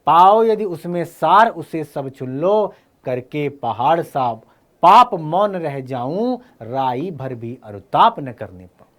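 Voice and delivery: slow verse with strong line openings; measured, emphatic poetry reading; poem recitation. Acted. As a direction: declaim it slowly, leaning on each phrase. Recites the poem being taught.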